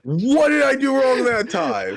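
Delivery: indignant. Real, not acted. Protesting being corrected.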